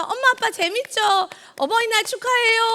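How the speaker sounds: a hint of an excited child; cheerful child. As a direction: chirp it like a child pleased with a prank. Child proudly greeting pranked parents.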